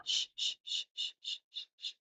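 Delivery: soft whispered "shh" fading away; exhaled shushing. Shown as a demonstration. Guiding parents to shush on the exhale, fading. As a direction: let the "shh" ride out on the breath. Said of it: "as you start to exhale".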